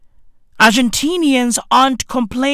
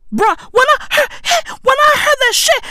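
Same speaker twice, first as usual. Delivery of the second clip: very high-pitched, halting shock; incredulous squeal; 14 semitones higher than usual. Acted. Comedian recalling hearing the accent, high-pitched incredulity. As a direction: climb way up high as you restart.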